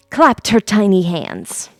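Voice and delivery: usual speaking voice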